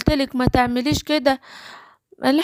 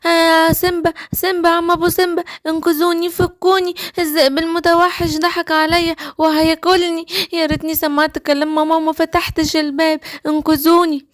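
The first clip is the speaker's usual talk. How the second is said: distressed little gazelle, pitched up; pleading captive gazelle. Acted.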